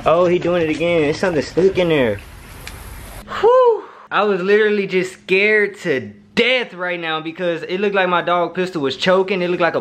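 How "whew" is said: high, loud whew; relieved whew. Real, not acted. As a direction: blow out the scare in a high hoot. Relief after dog's choking scare.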